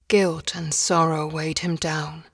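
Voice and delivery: plain reading voice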